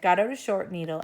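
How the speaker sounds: plain reading voice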